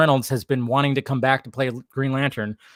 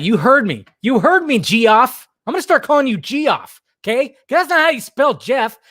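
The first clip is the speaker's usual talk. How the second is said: angry rant; 7 semitones higher than usual. Real, not acted. Ranting angrily at a viewer named Geoff. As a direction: snap at him over the spelling. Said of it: "I got a little angry at".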